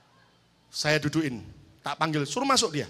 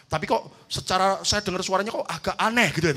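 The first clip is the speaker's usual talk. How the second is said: ordinary delivery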